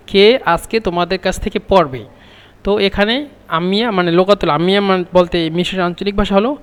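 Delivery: usual speaking voice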